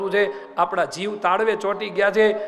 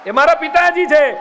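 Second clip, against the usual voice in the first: loud ringing declaration; fervent shout; proclaiming shout; 7 semitones higher, 7 dB louder than usual. Real, not acted. Preacher proclaims the girl's answer.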